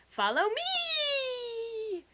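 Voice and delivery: high, very slow drawn-out call; mysterious beckoning. Retold or imitated. Edward beckoning Bella into the forest, high and very slow. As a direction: lure her into the forest. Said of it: "he's all like".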